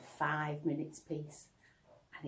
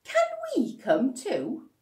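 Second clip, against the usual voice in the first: put-on high, loud and slow kid's plea; pleading child; 12 semitones higher, 9 dB louder than usual. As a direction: beg like a small child. Child begging to follow.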